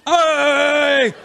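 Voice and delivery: high, loud hammed-up cheer; mock cheering. Comic mocking his own cheering at a video, high and loud. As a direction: cheer her on like a fanboy. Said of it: "And even I went".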